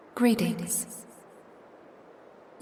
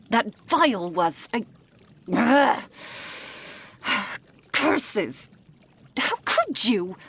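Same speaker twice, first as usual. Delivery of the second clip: exasperated, raised-voice tirade; furious scolding; 3 semitones higher, 4 dB louder than usual. Acted. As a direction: berate a careless apprentice, voice swooping up on key words. Mentor scolds apprentice over vial.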